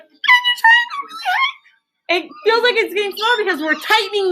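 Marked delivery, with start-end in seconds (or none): 0.0-1.5 strained squeaky falsetto; tight, squeaking high voice. Shown as a demonstration. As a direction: squeeze the voice up as high as it goes. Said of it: "So if you feel like".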